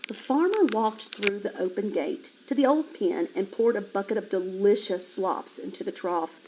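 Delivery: ordinary delivery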